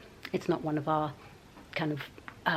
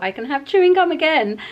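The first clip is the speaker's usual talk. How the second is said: excited; 9 semitones higher, 7 dB louder than usual. Retold or imitated. Excited thought about gum.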